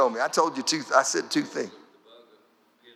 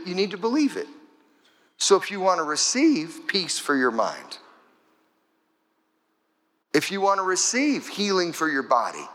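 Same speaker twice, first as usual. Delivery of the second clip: usual speaking voice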